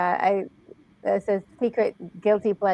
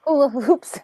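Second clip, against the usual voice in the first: normal talking voice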